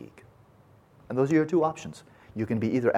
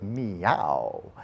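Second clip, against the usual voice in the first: a lightly voiced cat's meow; storyteller giving the cat's meow in a parable. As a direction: meow it like a hot, fed-up cat. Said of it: "And the cat goes".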